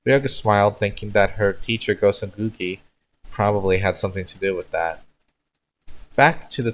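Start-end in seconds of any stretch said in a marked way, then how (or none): none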